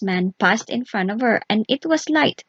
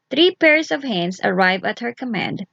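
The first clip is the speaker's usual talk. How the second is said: usual speaking voice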